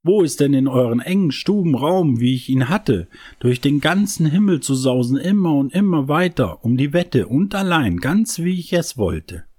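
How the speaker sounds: put-on a child star longing for the sky; a hint of a wistful small boy; star brother. A narrator's reading. Star brother speaking in story.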